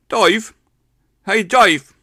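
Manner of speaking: loud high calling Dave as Dive, A said like I; Aylesbury dive. Retold or imitated. A lad from Aylesbury calling him out loud. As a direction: shout it out, Dave sounding like Dive. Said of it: "he pronounced an A like an I".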